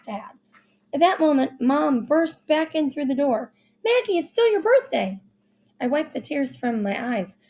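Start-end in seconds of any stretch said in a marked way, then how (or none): 3.8-5.2 urgent. A narrator's reading. Mom bursts in urgently.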